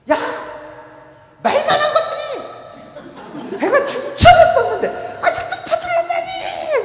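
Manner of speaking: very high, very loud screech; furious screaming. Acted. Angry mother screams her sleeping daughters awake. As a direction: scream at the top of your voice. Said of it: "큰 소리를 냅니다".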